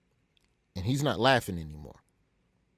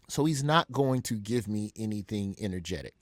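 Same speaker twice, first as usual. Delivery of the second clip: ordinary delivery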